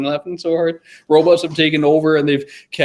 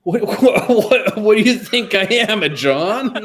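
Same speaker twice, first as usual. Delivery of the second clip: laughing indignant; 6 semitones higher, 3 dB louder, slower than usual. Pretend. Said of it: "It's like".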